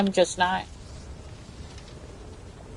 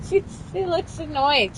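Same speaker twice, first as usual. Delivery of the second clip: normal talking voice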